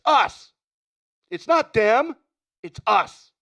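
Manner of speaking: impersonating Tom Hanks, high and loud ranting. Retold or imitated. Quoting The Burbs to Art, frantic high shouting. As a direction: scream the rant at Art like a man losing it. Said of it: "see if you can guess it".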